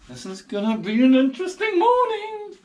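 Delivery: high, strained worried quip; bracing for trouble in the morning; nervous wry remark. Retold or imitated. Gideon wary after smashing altar.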